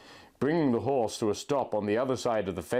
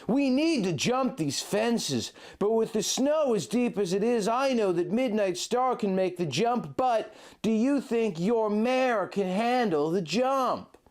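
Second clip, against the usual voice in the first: Link, tender guide; 8 semitones higher than usual. A narrator's reading. Link guiding his love through the blizzard, high and gentle. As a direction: lead her by voice through the snow. Link's voice in the story.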